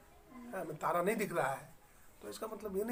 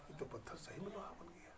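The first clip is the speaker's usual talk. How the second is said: whispering in stunned disbelief; hushed whisper of amazement at the miracle; awed whisper; 16 dB quieter than usual. Retold or imitated. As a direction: breathe it out, barely voiced, astonished. Disciple stunned, whispering in awe.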